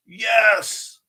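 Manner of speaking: loud, high cheer; excited yes. Real, not acted. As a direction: shout yes in triumph. Excited reaction to chat.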